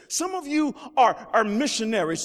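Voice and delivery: ordinary talk, nothing marked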